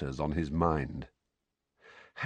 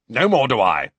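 forceful; 4 semitones higher, 9 dB louder than usual. A narrator's reading. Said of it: "said Uncle Vernon forcefully".

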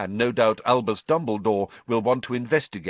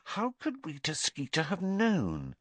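higher, softer musing; thinking aloud, half to herself; puzzled Hermione; 10 semitones higher, 6 dB quieter than usual. A narrator's reading. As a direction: Hermione wondering, soft and high.

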